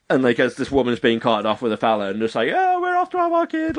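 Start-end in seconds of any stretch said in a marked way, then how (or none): 2.5-3.8 high, gleeful imitation; dead happy. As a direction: chirp it in mock delight. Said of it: "like, dead happy".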